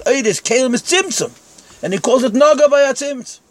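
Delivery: high-pitched, insistent puzzlement; animated objection. Real, not acted. Teacher pointing out a contradiction to students, animated and insistent. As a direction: press the contradiction with rising urgency.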